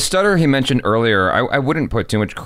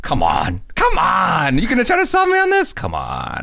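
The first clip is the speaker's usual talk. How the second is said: incredulous mock disbelief; 7 semitones higher than usual. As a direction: scoff like you don't buy a word. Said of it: "Just like".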